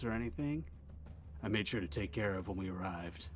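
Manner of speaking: lightly voiced laid-back rocker; low, soft, unbothered character voice; Flo. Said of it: "the kind of rocker stepping out".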